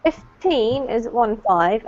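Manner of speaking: put-on Fifteen slowed, the TEEN syllable rising and stressed. Shown as a demonstration. Teacher explaining number stress to students, slow TEEN stress. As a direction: lean on TEEN in Fifteen, slowly. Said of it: "emphasis comes on the second syllable".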